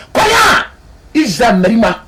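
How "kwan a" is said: high crying shout; preaching cry. Acted. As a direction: cry it out from the pulpit. Preacher cries out at climax.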